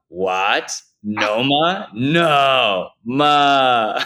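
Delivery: high, loud, drawn-out noma; No, ma pulled apart in a loud high yell; excited shout. Retold or imitated. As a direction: shout it like you can't believe it.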